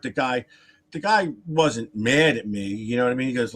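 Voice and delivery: ordinary delivery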